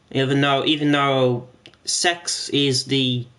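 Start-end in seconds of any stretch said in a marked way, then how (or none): none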